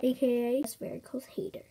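normal talking voice

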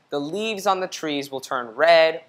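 ordinary delivery, nothing marked

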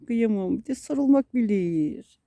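normal talking voice

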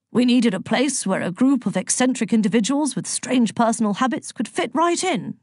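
blustery, jovial god; Zeus, genially explaining. Acted. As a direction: explain grandly and with relish. Voices Zeus in the story.

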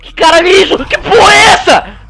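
panicked high shouting; screaming in sudden fright; scared horror game streamer. Real, not acted. (gritando apavorado de susto)

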